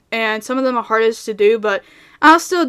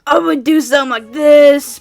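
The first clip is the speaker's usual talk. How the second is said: louder, gruff character voice; gruff Tank voice; 3 semitones higher, 5 dB louder than usual. Shown as a demonstration. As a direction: put on a gruff, forceful character voice. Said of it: "whenever I used to voice Tank".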